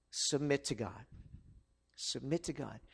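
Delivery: dropping to a near hush. Acted. Preacher urging listeners quietly to submit to God. Sermon drop to hushed.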